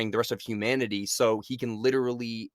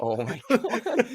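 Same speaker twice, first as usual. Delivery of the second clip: laughing; 12 semitones higher, 3 dB louder, much slower than usual. Real, not acted. Laughing exclamation.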